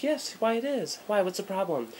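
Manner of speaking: lightly mocking mother answering the officer; prim mom voice. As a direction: put on a prim, proper mom's voice. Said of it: "She goes".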